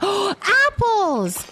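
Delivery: high, loud gasp of delight on Apples; excited gasp. Real, not acted. Streamer spotting apples in her game, gasping with delight. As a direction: gasp and cry out "Apples!" like finding treasure.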